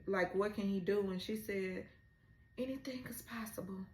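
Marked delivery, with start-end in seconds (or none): 2.6-3.8 whispered, breathy retelling of her line. Retold or imitated. She answers him in a whisper. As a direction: hush it like a secret promise. Retold quote from the show.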